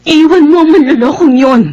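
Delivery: high, bitter outburst near tears; distraught, indignant cry; tearful anger. Acted. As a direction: urge her angrily, pitch climbing as you go. Urges rival to leave the cheat.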